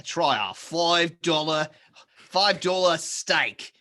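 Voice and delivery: very slow, drawn-out Aussie steakhouse pitch; Australian ad voice. Acted. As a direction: stretch it out like an Australian commercial. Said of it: "Why was that the voice?".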